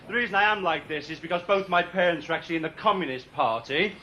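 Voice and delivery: ordinary talk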